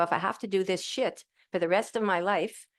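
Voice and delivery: usual speaking voice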